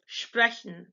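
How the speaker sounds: sprechen starting "shp"; German SP sound. Shown as a demonstration. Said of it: "pronounced like sh".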